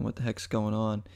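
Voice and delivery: ordinary talk, nothing marked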